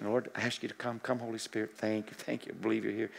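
soft prayer. Shown as a demonstration. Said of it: "If I'm praying for somebody".

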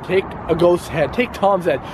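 ordinary delivery

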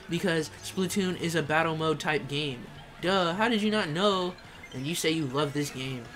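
normal talking voice

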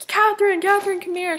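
high-pitched mock mom summons; mom calling out. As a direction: imitate an excited mom calling her over. Mom calling her across store.

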